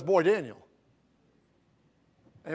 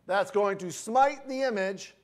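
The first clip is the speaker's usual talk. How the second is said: usual speaking voice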